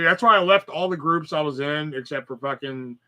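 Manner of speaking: ordinary talk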